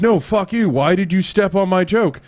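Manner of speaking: high, heated retold protest; mock-angry, pitched well up; imitating someone pissed. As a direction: mimic an indignant confrontation. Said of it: "he was fucking pissed".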